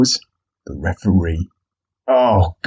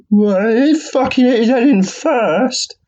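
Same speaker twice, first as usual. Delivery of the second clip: much higher, slow whiny schoolboy; cornered kid; much slower than usual. Retold or imitated. Boxers answering the referee like cornered schoolkids, high whine. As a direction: sulk like a kid in trouble. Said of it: "like two kids being cornered in the principal's office".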